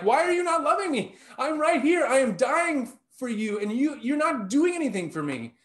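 high, loud pleading cry of abandonment; Jesus on the cross, crying out to his Father in anguish. Retold or imitated. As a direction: cry out to God as if dying. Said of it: "The ultimate moment of like".

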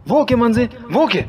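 calling out. Acted. Friend finding him collapsed, urgent rising calls to wake him.

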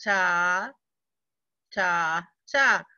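"ta" chanted evenly on each beat; steady beat-by-beat "ta" reading; rhythm syllables. Shown as a demonstration. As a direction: tap out each "ta" like a quarter note.

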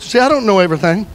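ordinary talk

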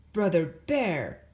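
a firm dad calling his son; stern father. A narrator's reading.

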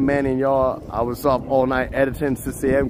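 usual speaking voice

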